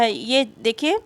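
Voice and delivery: normal talking voice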